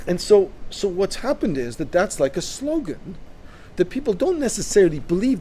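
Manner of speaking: ordinary talk, nothing marked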